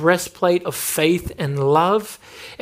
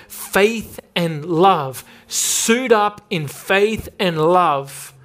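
very slow, weighted words; slow emphasis; 2 semitones higher, much slower than usual. Acted. Preacher answering his own question, slow and weighted. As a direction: lay each word down slowly.

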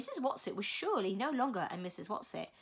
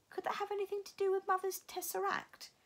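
put-on young girl voice; 10 semitones higher than usual. Meg's inner question.

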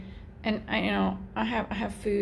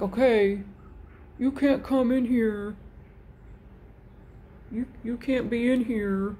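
voicing the cat Evie. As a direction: play the grouchy cat guarding the door. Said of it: "she'll come and sit right here, like".

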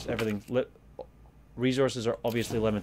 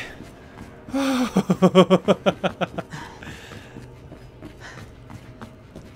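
nervous laugh; 8 semitones higher, 5 dB louder than usual. Real, not acted. Nervous laughter after scare.

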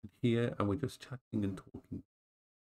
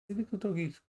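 higher, soft cooing at the little dogs; 8 semitones higher, 5 dB quieter, faster than usual. Real, not acted. Owner cooing to her little dogs, soft and high. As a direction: coo it like to a puppy.

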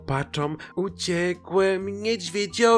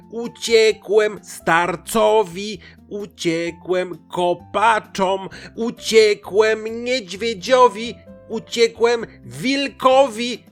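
slow, loud, high, emphatic shouting; furious pancake, every word stretched; seriously angry; 9 semitones higher, 6 dB louder, slower than usual. A narrator's reading. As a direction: spell it out loudly for a deaf listener. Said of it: "Placek wkurzył się nie na żarty".

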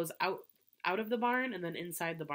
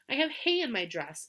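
calling out; 6 semitones higher, 5 dB louder than usual. A narrator's reading. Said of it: "called Fern".

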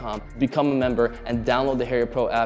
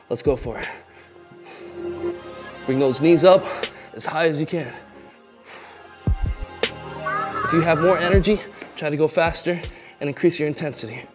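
workout coach hype; 4 semitones higher than usual. Real, not acted.

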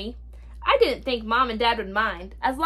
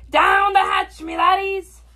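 high, loud captain's toast; pirate yell; 8 semitones higher, 6 dB louder than usual. A narrator's reading. Braidbeard yells to his crew at dinner, loud yell. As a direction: yell it to the whole table. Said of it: "pounded his fist on the table and yelled".

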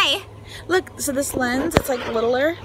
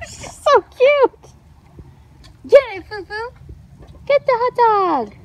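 high, louder doggy talk; 9 semitones higher, 6 dB louder than usual. Real, not acted. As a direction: coo and urge the puppy on. Cooing at dog.

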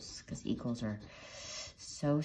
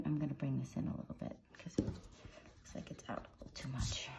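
soft whisper to herself; whispered aside; 2 semitones lower, 8 dB quieter than usual. Real, not acted. Tutor whispering to herself while fixing the R. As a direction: breathe it out as a private note.

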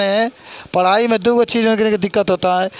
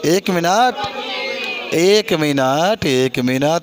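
very slow, stretched "one minute" calls; drawn-out hold-on; 3 semitones lower, much slower than usual. Real, not acted. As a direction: stall the eager class with long, lingering vowels. Holding back the answering class.

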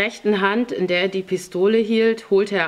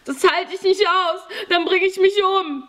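put-on very high, distraught boy's cry; panicked wailing; 13 semitones higher, 4 dB louder than usual. A narrator's reading. Terrified boy hears what prison holds, panicked wailing. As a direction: wail in sheer despair.

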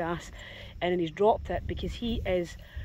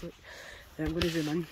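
1 dog's voice; 7 semitones lower, 12 dB quieter than usual. Acted. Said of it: "And he goes".

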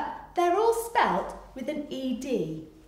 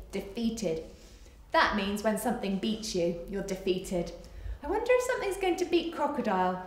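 ordinary delivery, nothing marked

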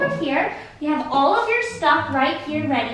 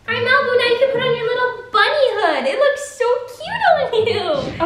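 high cooing praise; 9 semitones higher than usual. Real, not acted. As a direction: coo at a puppy. Cooing over the dog.

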